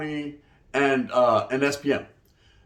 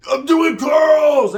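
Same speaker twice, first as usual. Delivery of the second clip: slow, stretched shout, much higher and louder; mock shout; 9 semitones higher, 7 dB louder, much slower than usual. Mocking a gym guy yelling in public. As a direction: yell it out slow like a meathead.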